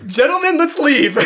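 high, loud, laughing urge to get out; panicked laughing plea. Acted. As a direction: blurt it out, half laughing, desperate to leave. Panicked urging to leave, laughing.

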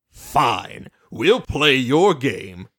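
low, slow villain voice with drawn-out menace; a deep looming trickster, taunting; menacing Discord voice. Retold or imitated.

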